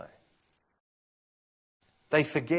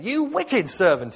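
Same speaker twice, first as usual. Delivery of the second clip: 1 angry rebuke; 9 semitones higher, 12 dB louder, slower than usual. Acted. Master rebukes the servant.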